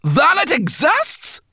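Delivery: very high, loud amazed cry; excited cry. A narrator's reading. Sorrel cries out at the news, high and loud. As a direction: burst out amazed. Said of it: "cried Sorrel".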